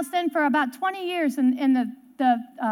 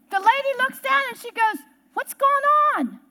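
tag raised alarm; 7 semitones higher than usual. Retold or imitated. Tarantula scene, voice stays raised.

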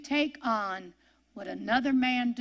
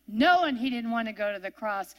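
normal talking voice

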